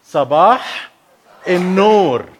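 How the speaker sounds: modelling "Sabah in Nur" for them to copy; "Sabah" with a rise, then "in Nur", as a teacher; Arabic reply demo. Shown as a demonstration. Speaker teaches the congregation the Arabic reply, modelling it. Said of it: "You say".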